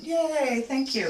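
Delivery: high-pitched, delighted little cheer; pleased, lifted thanks; happy cheer. Real, not acted. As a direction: cheer "yay" high, then thank them. Cheers students attending live.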